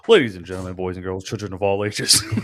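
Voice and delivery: deep, booming ring announcer call; Road Dogg wrestling intro. Retold or imitated. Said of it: "Road Dogg, Jesse James, Badass Billy Gunn, New Age Outlaws".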